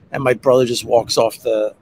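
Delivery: ordinary talk, nothing marked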